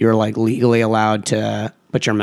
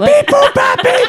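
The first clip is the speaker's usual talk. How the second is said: high, loud robot beeps; squeaky robot, louder and high; 4 dB louder than usual. Pretend. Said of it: "sign off at once in harmony with our robot noises".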